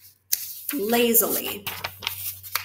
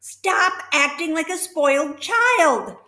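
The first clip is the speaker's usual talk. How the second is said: higher and louder, scolding; the pig scolds the rat; 8 semitones higher, 7 dB louder than usual. Acted.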